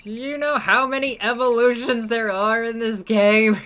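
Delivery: shouted, high-pitched no; loud dismay. Real, not acted. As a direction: cry out in disbelief. Dismay at many evolutions.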